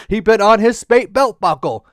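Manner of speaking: ordinary delivery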